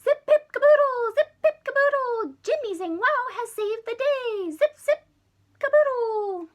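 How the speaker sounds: very high, loud squeaky chant; Marsmen cheering. Acted. Marsmen cheering for Jimmy in shrill delight. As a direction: cheer it out like tiny aliens. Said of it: "the Marsmen cheered".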